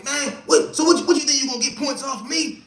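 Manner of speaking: high, loud mocking voice; mocking a defensive guy. Imitating a guy who gets pressed, high and loud. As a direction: pitch it way up, defensive and scoffing. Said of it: "they tell me".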